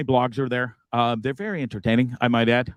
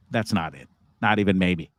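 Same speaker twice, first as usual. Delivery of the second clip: usual speaking voice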